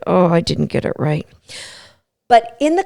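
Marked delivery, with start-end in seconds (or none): 0.1-1.3 deflated dog voice. Retold or imitated. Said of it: "they're so deflated".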